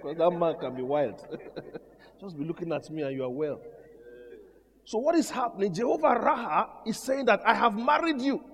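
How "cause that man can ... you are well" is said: soft, low-key aside with a chuckle; murmuring to John off to the side; quiet aside. Real, not acted. Pastor quietly teasing John from the pulpit, low aside.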